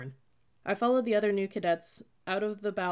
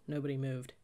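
plain reading voice

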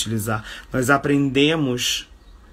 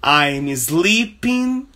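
very slow, higher example sentence; slow teaching model; 5 semitones higher, 4 dB louder, much slower than usual. Shown as a demonstration. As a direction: model it slowly, lifting the voice. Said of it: "Uh, I'm".

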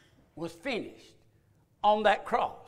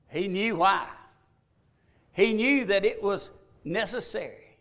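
usual speaking voice

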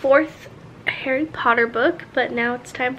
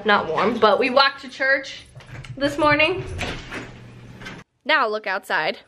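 usual speaking voice

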